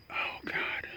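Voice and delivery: breathy unvoiced whisper, nearly silent; whispered oh God. Real, not acted. Dizzy man whispers to himself, a real unvoiced whisper. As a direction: let it slip out as a bare whisper.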